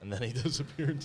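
breaking into a laugh mid-line. Real, not acted. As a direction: laugh through disappeared. Retelling ghost story, laughing.

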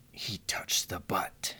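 slow breathy whispered quote. Pretend. Whispering the movie quote slowly for her partner to guess. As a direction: whisper it slowly so the other can lip-read.

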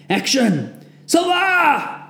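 high, loud final yell; shouted order. A narrator's reading. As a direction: send them running. Sergeant sends them off.

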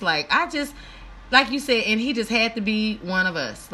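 ordinary talk

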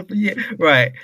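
normal talking voice

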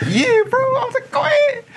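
very high, loud meek voice; squeaky timid reply. Retold or imitated. Himself answering the angry cashier: very high meek squeak. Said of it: "I'm like".

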